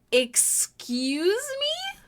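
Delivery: amazed mock outrage; outraged stretched excuse me. Real, not acted. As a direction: Excuse me? slow, soaring high on me. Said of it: "I hope you never say".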